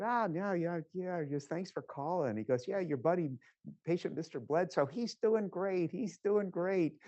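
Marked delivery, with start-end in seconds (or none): 0.0-2.4 playful put-on caller voice, pitched high; imitating Tony Fauci. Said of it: "I can't imitate his Brooklyn accent or Bronx accent". 2.7-7.0 high, louder mock Brooklyn-ish voice of an old friend; playful put-on caller voice, pitched high; imitating Tony Fauci. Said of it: "I can't imitate his Brooklyn accent or Bronx accent".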